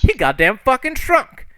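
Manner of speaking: very high, loud indignation; acted furious protest; outraged rant. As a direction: burst out in disbelief on someone's behalf. Character rants about long wait.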